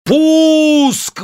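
high, loud joyful shout; preacher launching the ship with joy; joyful full-voice cry. Acted. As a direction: cry it at the top of your voice. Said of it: "во весь голос радостно возгласил".

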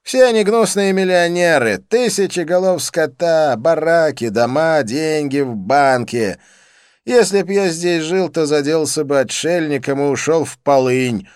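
loud, high, manic declaiming; exuberant Dean, ranting. A narrator's reading. Dean raving about rich cattlemen, exuberant rant. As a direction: rant with relish, starting high and easing down. Voices Dean's tirade.

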